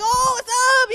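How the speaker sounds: imitating dad's street voice greeting friends. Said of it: "talk like".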